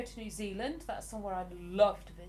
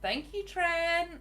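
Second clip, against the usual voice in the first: high, loud, drawn-out thanks; delighted shout-out; 8 semitones higher, 3 dB louder, slower than usual. Real, not acted. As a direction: sing out thanks to a viewer. Grateful shout-out to a viewer.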